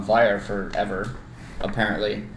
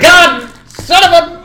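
high, loud, slow mock cry of dismay; exasperated outburst; 10 dB louder, slower than usual. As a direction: curse loudly on being caught. Retold dismay at being found out.

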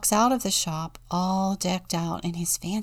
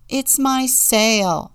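loud, slow, high goat wail; bleating goat; 6 semitones higher, 7 dB louder, much slower than usual. A narrator's reading. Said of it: "bleating loudly".

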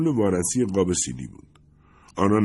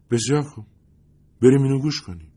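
lightly voiced Lindy, keen to move on; higher, brisk woman's voice; 7 semitones higher, 4 dB louder, faster than usual. Lindy getting up to play the CD, brisk and higher. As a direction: perk up and head for the stereo.

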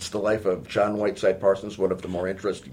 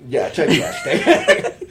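laughing; 7 semitones higher, 9 dB louder, slower than usual. Real, not acted. Laughing at typecasting joke.

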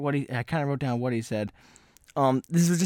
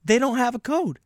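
exasperated; 10 semitones higher than usual. Real, not acted. Exasperated disbelief.

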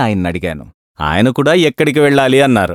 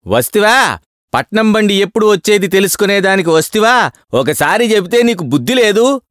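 higher, exasperated telling-off; scolding clerk; 10 semitones higher than usual. A narrator's reading. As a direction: scold him for asking twice.

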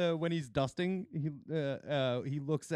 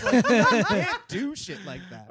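laughing scold; 5 semitones higher, 8 dB louder, slower than usual. Real, not acted.

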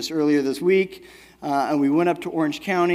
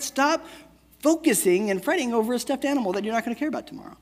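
higher, bright lecturing dad voice; 5 semitones higher, faster than usual. Retold or imitated. Father mock-lecturing his crying daughter in the aquarium, hammed-up dad voice. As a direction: preach it like a know-it-all dad. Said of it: "typical dad, boring lesson stuff".

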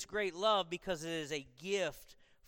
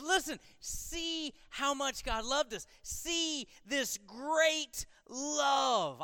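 higher, slowed, wondering call to look; excited John; 7 semitones higher, much slower than usual. Retold or imitated. John urging readers to see God's love, excited. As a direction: stretch out "great love" like John can't contain it. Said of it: "John was excited".

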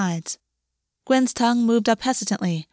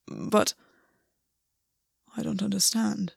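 quiet, halting confusion; baffled; 6 dB quieter than usual. A narrator's reading. Gwen fails to understand August's demand, quietly baffled. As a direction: falter after the first word, softly.

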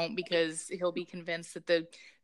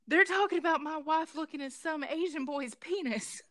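high-pitched imitation of the husband; imagined husband overhearing, hammed-up; mock husband; 7 semitones higher than usual. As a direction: put on the husband's voice, pitched well up. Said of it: "You imagine him".

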